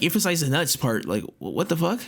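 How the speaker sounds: normal talking voice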